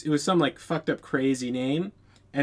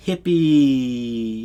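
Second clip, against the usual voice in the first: "hippie" with a long drawn-out last vowel; spell-like drawl; 3 semitones lower, 4 dB louder than usual. Real, not acted. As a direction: stretch out "hippie" as if casting a spell. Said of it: "what am I casting a spell?".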